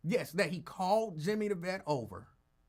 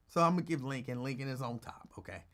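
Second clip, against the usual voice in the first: ordinary delivery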